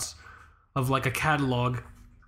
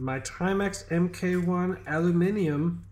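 slow, drawn-out British aluminium; measured reveal, British pronunciation of aluminium; British accent; 4 semitones higher, slower than usual. Acted. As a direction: announce it slowly with British aluminium. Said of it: "say with a British accent".

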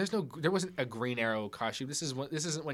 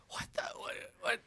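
hushed, stammered mock disbelief; baffled whisper; 7 dB quieter than usual. Son reacting to his mother's remade dress, baffled whisper. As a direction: whisper it as if lost for words.

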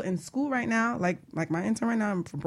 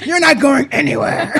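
louder, laughing through the words; 9 dB louder than usual. Real, not acted. Host jokes intern can't leave, laughing loudly. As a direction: laugh while joking.

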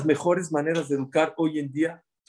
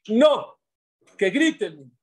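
firm shouted no; 5 semitones higher, 6 dB louder, much slower than usual. Shown as a demonstration. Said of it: "que le enseñen a sus hijos que griten".

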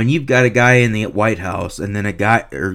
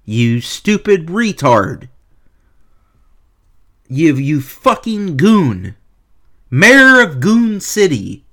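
slow contemptuous insult; 3 semitones higher, slower than usual. Real, not acted. Angry rant at beat writer.

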